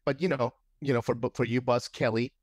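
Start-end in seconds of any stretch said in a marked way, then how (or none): none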